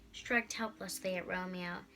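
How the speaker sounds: plain reading voice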